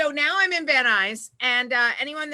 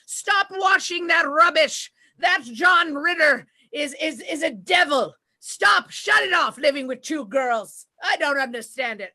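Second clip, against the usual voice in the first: put-on loud, scolding Russian-accented grandmother; Russian-sounding grandma; 3 semitones higher, 4 dB louder than usual. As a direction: shout orders in a hammed-up Russian accent, easing off at the end. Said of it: "grandma sounds like she's Russian".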